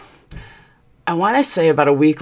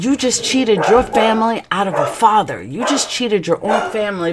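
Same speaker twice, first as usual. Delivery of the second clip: higher, pained reproach; retold accusation; 6 semitones higher than usual. Wife accusing husband of robbing the family, reproachful. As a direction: lay the blame on him, voice raised in pitch. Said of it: "It was bad".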